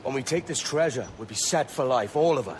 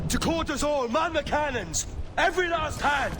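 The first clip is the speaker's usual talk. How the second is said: high, carrying battle orders to the crew; rally the whole ship to the cannons; calling out orders. Acted. Captain bellows orders to his crew across the deck.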